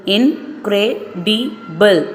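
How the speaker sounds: "incredible" stretched into four slow beats; slow syllable by syllable. Shown as a demonstration. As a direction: count out "incredible" slowly. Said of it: "four syllables".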